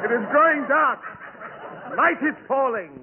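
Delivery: dramatic omen. Acted. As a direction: announce nightfall like a doom prophet. Dramatic ominous announcement.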